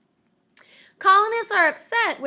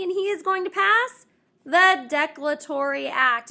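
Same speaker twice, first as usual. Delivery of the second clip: ordinary talk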